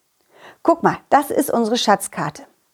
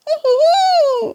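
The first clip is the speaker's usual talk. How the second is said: high squeaking giggle; a tiny excited squeal; squeaky giggle. Acted. Ball squeaking.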